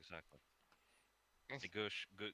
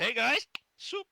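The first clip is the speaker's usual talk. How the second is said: mock server voice; 16 dB louder, slower than usual. Player voicing character serving soup.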